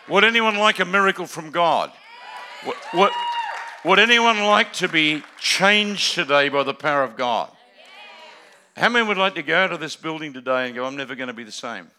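loud, high altar-call appeal; preaching at full force. Real, not acted. Preacher appealing to congregation, loud altar call. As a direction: call out to the whole room.

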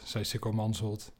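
usual speaking voice

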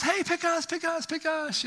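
very high, calling little voice; high squeaky Q-tips begging; eager Q-tips. Pretend. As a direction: wave to get picked from the shelf. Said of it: "a box of Q-tips says".

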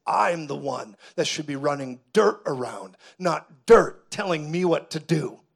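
acted indignation with punched words; devil's rage. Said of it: "the devil's rage erupted on the scene".

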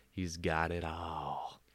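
slow, low, weighty delivery; slow dramatic repeat. Shown as a demonstration. Host repeats it about the snake, slow and mock-solemn. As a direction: let each word land slowly, mock-solemn.

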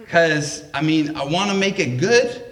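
ordinary talk, nothing marked

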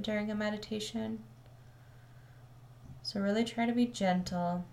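calm, measured meditation voice with long pauses; settled, even delivery, leaving space between phrases; guided meditation. Acted. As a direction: guide listeners gently into the breath, unhurried.

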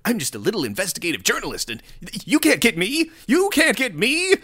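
mock smug journalist, rising high; playful mock of a smug pundit; mocking Tim Pool. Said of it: "like, calm and, like".

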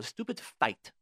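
ordinary talk

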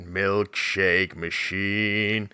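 low, slow, hushed doom; ominous hush. Acted. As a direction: drag it out like a dark omen. Ominous echo of the warning.

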